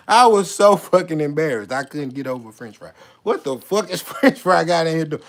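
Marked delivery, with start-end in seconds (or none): 0.1-1.7 full-voiced confession, leaning on the swear; openly exasperated admission; emphatic embarrassment. Same confession, repeated louder.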